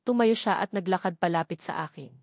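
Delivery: ordinary delivery, nothing marked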